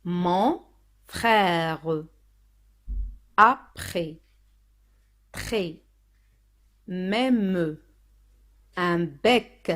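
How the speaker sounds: slow word list, syllables pulled apart; reading out words. Shown as a demonstration. Teacher reading the word sheet for learners, slow and deliberate. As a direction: leave long pauses between carefully sounded words. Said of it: "Je lis les mots".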